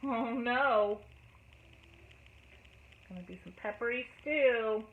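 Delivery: plain reading voice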